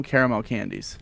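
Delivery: "caramel" in full, CARE-uh-mel; three-syllable CARAMEL. Shown as a demonstration. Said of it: "I will call 'em".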